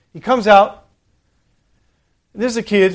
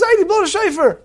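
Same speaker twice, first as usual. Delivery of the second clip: put-on high, whiny plea of a crying toddler; crying little boy; 11 semitones higher than usual. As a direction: beg like a sobbing three-year-old, pitched up high. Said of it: "He started crying".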